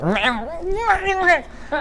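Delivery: high squeaky alien croak; E T impression noise. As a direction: mimic E T in a tiny strangled voice. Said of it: "doing impressions of E T where he'd be like".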